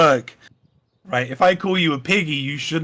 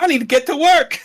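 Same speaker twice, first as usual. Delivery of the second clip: mock impatient woman; 12 semitones higher, 5 dB louder, faster than usual. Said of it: "She's like".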